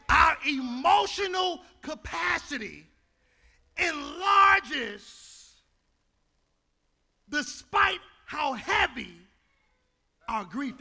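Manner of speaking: rising preacher cadence; preaching. Real, not acted. Pastor to congregation, preaching with rising force. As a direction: lift the congregation with it.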